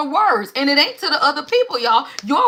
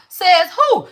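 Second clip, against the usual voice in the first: indignant challenge; 6 semitones higher, 5 dB louder than usual. Real, not acted. Challenging the one-way view of God.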